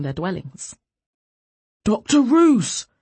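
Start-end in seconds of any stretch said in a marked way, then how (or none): 1.8-2.9 high, loud, drawn-out call. A narrator's reading. Alarmed neighbor to arrested doctor, calling out. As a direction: call urgently across the street. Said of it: "one of them called".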